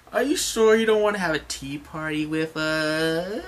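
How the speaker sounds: high, coaxing Okama invitation; lightly voiced sweet-talking Okama. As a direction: Okamas tempting the Marines to a tea party, sweet-voiced. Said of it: "They're like".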